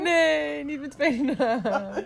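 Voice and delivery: high, loud laughing protest. Real, not acted.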